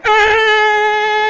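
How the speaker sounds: frustrated cry. Retold or imitated. Holding zero on automated line.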